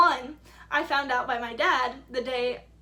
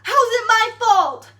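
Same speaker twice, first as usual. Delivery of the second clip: much higher and louder, outraged; exasperated outcry; 9 semitones higher, 14 dB louder than usual. Retold or imitated. The teacher demanding how it's her fault, loud outraged shout. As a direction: shout the question in disbelief. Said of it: "She's like".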